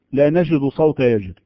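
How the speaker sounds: ordinary talk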